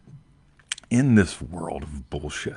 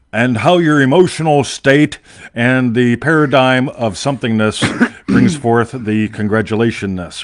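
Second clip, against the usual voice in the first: mock guru voice; 6 semitones higher, 10 dB louder than usual. Mocking quote of Neville video.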